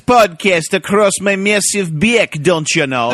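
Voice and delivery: put-on high, loud stage Irish accent. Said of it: "he did sound Irish".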